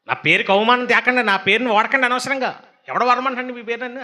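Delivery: a stern God warning people off his name; God's rebuke. Retold or imitated. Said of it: "అంటున్నాడు ఆయన". (కోపంగా దేవుని గద్దింపు)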